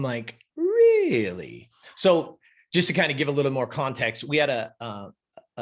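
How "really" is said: high, long amazed really; surprised. Retold or imitated. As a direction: stretch it up in disbelief. Amazed reaction.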